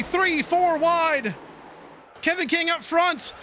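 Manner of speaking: excited commentator calling a photo finish. Real, not acted. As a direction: build the call as they cross the line.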